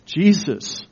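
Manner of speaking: usual speaking voice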